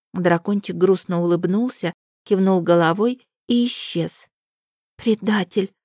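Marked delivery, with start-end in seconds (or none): none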